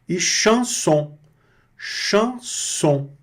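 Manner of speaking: high, slow "chanson", nasal "chan". Shown as a demonstration. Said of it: "destacando a pronúncia do chan". (chanson com chan nasal)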